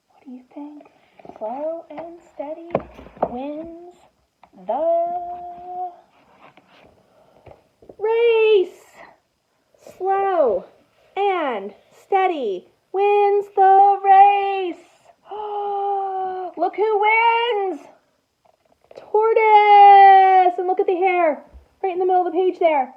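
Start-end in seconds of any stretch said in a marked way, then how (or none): 1.4-14.8 building excited refrain. Acted. Teacher reading the race's end to the class, swelling to a breaking cry. 15.3-16.5 excited gasp. Acted. Tortoise wins. 16.6-20.6 high and loud, winner's name called out; thrilled reveal. Acted. Teacher revealing the winner to the class, thrilled and loud.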